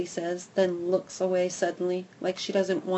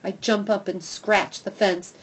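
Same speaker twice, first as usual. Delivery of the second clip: usual speaking voice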